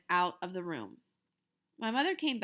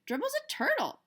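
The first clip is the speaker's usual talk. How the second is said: mother. Acted. Mother dismissing, voiced.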